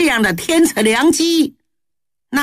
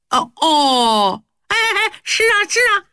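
sudden delighted realization; 9 semitones higher than usual. Acted. Huhai suddenly grasps the plot.

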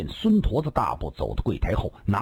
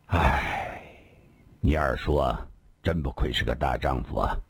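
sighing; 8 semitones lower than usual. Acted. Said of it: "长长叹了口气".

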